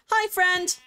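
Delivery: high, bright hello to an animal; excited hi to a sheep; excited greeting. Real, not acted.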